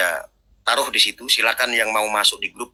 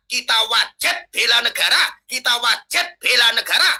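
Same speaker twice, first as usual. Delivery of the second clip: rousing preaching; 3 dB louder than usual. Acted. Rousing patriotic call to arms.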